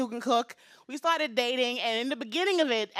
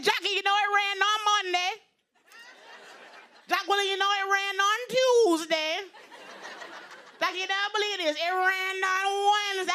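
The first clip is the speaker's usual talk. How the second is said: put-on high, insistent Jamaican-accented weather report; Jamaican mother; 7 semitones higher than usual. Mom reporting daily weather to daughter, high Jamaican accent. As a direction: imitate a Jamaican mom, pitched way up. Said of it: "her very heavy Jamaican accent".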